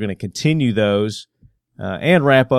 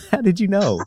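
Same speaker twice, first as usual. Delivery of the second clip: laughing; 8 semitones higher than usual. Real, not acted. Laughing at Prius joke.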